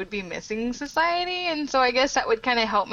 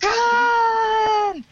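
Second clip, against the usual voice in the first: drawn-out high shout of Khan; Kirk's Khan yell; 13 semitones higher, 6 dB louder than usual. Retold or imitated. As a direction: bellow Khan like Captain Kirk. Wrath of Khan movie quote.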